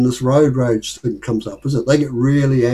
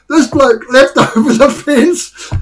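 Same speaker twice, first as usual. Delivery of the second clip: slow, louder, higher laughing telling; 9 semitones higher, 6 dB louder, slower than usual. Real, not acted. Telling the lawn-watering story to friends, laughing. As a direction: laugh through the punchline.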